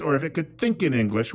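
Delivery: usual speaking voice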